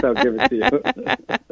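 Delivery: laughing. Real, not acted. Laughing at her own joke.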